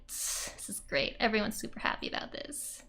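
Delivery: dry whispered aside, deadpan; sarcastic whisper. Pretend. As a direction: whisper it sarcastically under your breath. Sarcastic aside about the manga scene.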